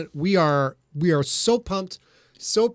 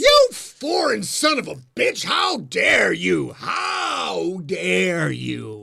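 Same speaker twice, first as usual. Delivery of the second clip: hammed-up furious yell, drawn out at the end; betrayed fan's over-the-top rant; mock outrage; 3 dB louder, much slower than usual. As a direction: yell it in theatrical indignation, dragging out "how dare you". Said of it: "one of the people who was super pissed".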